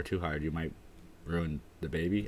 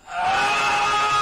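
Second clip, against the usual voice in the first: sudden yell; 10 semitones higher, 8 dB louder than usual. Real, not acted.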